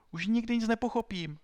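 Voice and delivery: very high, loud promise; desperate pleading. A narrator's reading. Pupil promising as the hands reach him, desperate. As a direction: promise frantically, voice shot up high. Alfred begs to keep cockade.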